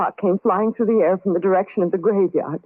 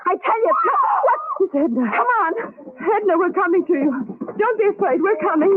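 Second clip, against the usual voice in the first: much higher, urgent frightened calls; frantic calling out; 10 semitones higher than usual. Acted. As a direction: call out in panic to the sick friend. Hears Edna, rushes to her.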